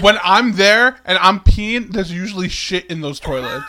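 emphatic. Real, not acted. Emphatic disgusted complaint.

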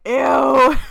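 loud, high, drawn-out ew; playfully repulsed outburst; mock disgust. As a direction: recoil loudly in exaggerated disgust. Mock disgust at formal greeting.

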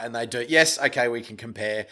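ordinary talk

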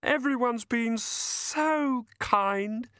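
very high, drawn-out, weepy gratitude; tearful fairy. A narrator's reading. Cheerful fairy dabbing her streaming eyes, tearfully grateful. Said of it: "dabbing at her streaming eyes".

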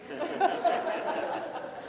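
silent, airy high laugh; soft wheezing chuckle; breathless laugh. Real, not acted. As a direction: laugh under your breath. Laughing at own joke.

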